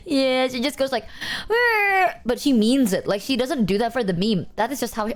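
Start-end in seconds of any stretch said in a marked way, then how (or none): 1.5-2.2 high-pitched mock "rrr" of rage; comic angry growl; imitated ragey growl. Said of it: "it literally is like the, the, like, ragey".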